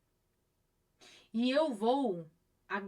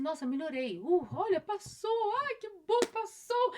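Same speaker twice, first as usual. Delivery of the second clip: much higher, delighted "passou" rising at the end; relieved joy; 9 semitones higher, 4 dB louder than usual. Retold or imitated. Imitating a viewer relieved after a crisis, excited. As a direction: act out giddy relief as it fades. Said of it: "você vai falar assim".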